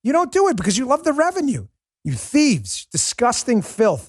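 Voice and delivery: high, heated tirade at YouTube; angry rant. Real, not acted. Host rails at YouTube for banning his videos, angry. Ranting at YouTube over bans.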